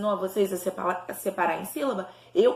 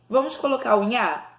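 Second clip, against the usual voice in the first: usual speaking voice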